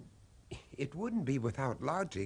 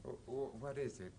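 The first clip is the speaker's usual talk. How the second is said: much softer, halting and uneasy; nervous hush; 11 dB quieter than usual. Acted. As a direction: creep up on the words, stammering. Nervously approaching the cabinet.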